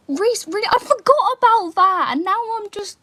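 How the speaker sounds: high, loud outburst of dismay; exasperated protest. Real, not acted. Teasing friend brought back something forgotten, loud high-pitched protest. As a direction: protest loudly, pitched right up. Mock indignation at friend's reminder.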